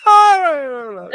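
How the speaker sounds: high, loud burst that drops away; exasperated. Real, not acted. Co-host conceding the argument, exasperated. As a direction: throw it out high, then back off.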